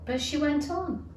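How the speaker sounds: ordinary delivery, nothing marked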